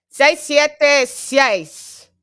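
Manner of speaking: high, loud, slow Spanish count; playful Spanish flourish; mock Spanish announcer. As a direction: read the digits like a hype announcer. Playful Spanish number read.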